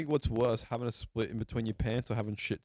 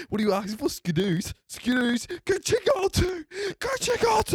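climbing from raised voice to high screech; mock exasperated yelling; 7 dB louder than usual. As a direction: send the customer off, losing it. Acted worker yelling directions.

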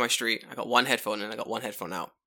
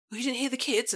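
put-on higher-pitched old woman, puzzled; lightly voiced old woman asking in surprise; 12 semitones higher than usual. Old woman questioning the man in headphones, puzzled. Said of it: "an old woman goes to me".

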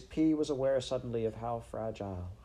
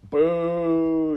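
long, high, loud jeer; shouted boo; 8 semitones higher, 11 dB louder than usual. Acted. Rat jeers from the sidelines, shouted. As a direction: boo him at full voice. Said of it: "shouted Botticelli".

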